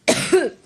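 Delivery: a chesty, hacking cough; real cough, clearing the chest; allergic cough. Real, not acted. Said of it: "once I get over my allergic reaction".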